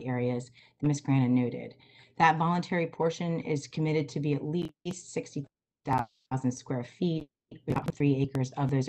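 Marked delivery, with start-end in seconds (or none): none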